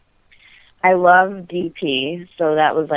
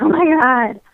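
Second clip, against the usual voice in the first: panicked, higher pitched "oh my God"; freaking out; 8 semitones higher than usual. Retold or imitated. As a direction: blurt it out in a rising panic. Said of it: "freaking out".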